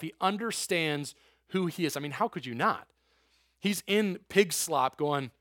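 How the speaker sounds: ordinary delivery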